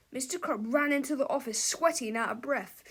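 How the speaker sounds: ordinary delivery, nothing marked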